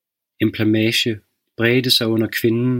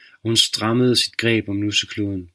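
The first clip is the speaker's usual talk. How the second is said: plain reading voice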